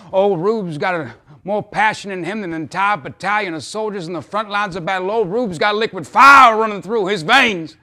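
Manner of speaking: loud, high, building to a shout; impassioned outburst. Acted. Father pleading his son's case to Mister Clark, impassioned and loud. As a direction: proclaim it with rising fervor.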